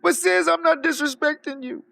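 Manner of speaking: hammed-up teary plea to his friend; whiny crying man. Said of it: "how much of a bitch he is".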